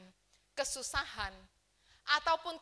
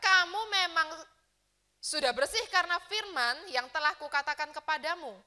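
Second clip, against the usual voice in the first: preaching scripture reading; 3 semitones higher, 8 dB louder than usual. Acted. Pastor reading the Bible verse aloud to the church, preaching loudly. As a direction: proclaim it to the whole congregation.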